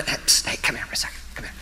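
hushed whispered beckon. Retold or imitated. Preacher retelling whispering to a child in the aisle. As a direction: sneak a whisper to the kid. Sneaking up to call the kid.

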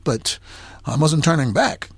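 ordinary delivery

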